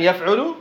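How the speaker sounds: "يفعل" said as yaf'ulu; middle vowel damma. Shown as a demonstration. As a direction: say "يفعل" with "u" after the ayn. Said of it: "يفعل بالفتح".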